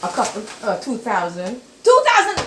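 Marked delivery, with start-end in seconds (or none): 1.8-2.4 much higher and louder mocking exclamation; scoffing disbelief. Grandma mocking grandson's two thousand dollars savings, loud and high. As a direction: blurt it out, scoffing at the tiny sum.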